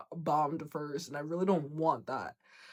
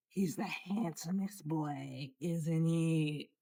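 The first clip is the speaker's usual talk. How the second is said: soft pet talk; 7 dB quieter, slower than usual. Real, not acted. Cooing at the cat.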